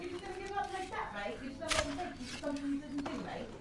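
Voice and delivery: high-pitched pleading retort; quick, high voice of a meddling stranger; mock urging character. Homeless man goading the arrested man, high mock urging.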